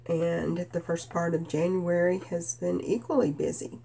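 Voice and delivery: usual speaking voice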